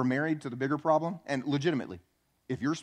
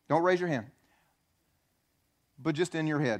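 usual speaking voice

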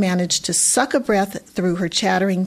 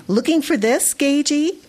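taunting; 6 semitones higher than usual. A narrator's reading. Girl taunting Gage.

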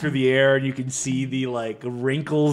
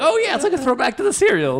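high, sing-song mock enthusiasm; mocking fan voice; 11 semitones higher than usual. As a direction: parody a gushing fan. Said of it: "and you're like".

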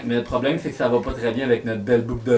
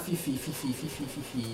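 much softer murmured calling of a name; 3 semitones lower, 17 dB quieter than usual. Acted. Magician softly calling Fifi out of hiding. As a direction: coax the name out under your breath.

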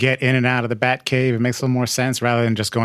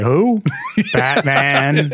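laughing quote; much slower than usual. Retold or imitated. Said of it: "calls somebody and they say".

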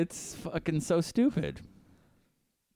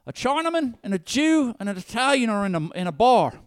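mock Australian comic; 6 semitones higher, 5 dB louder than usual. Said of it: "I have an Australian stand-up character".

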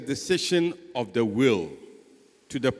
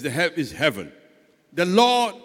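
ordinary talk

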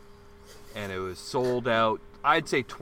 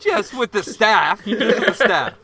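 higher, louder, laughing through it; laughing reply; 8 semitones higher, 9 dB louder, slower than usual. Real, not acted. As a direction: laugh off the tease.

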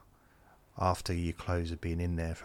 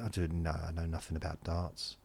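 normal talking voice

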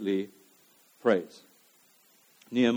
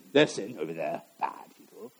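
low, dropped, scornful whisper; dismissive aside; 9 semitones lower, 5 dB quieter than usual. Retold or imitated. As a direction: sneer it under your breath. Mocking a blame-shifting voice.

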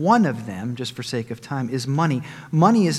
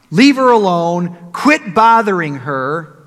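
louder, slower command; firm rebuke; 3 semitones higher, 9 dB louder, slower than usual. Acted. Preacher voicing Jesus rebuking disciples, loud and firm. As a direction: stop them firmly, leave her alone.

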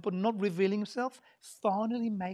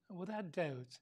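much softer, low and close; hushed; 7 semitones lower, 13 dB quieter than usual. Real, not acted. Preacher quietly telling the congregation of Mary's visit, hushed. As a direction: lean in and say it quietly.

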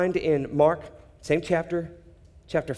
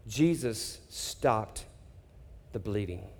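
slow, low and much softer; hushed slow climax; solemn hush; 7 semitones lower, 9 dB quieter, slower than usual. Real, not acted. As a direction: let each word land in reverence.

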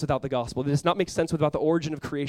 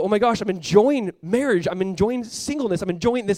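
excited, higher and louder voicing of a believer; imagine being pleasantly amazed and let it lift your voice; delighted discovery; 5 semitones higher, 6 dB louder than usual. Believer discovering the joy of submitting to the word, excited.

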